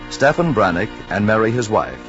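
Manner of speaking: usual speaking voice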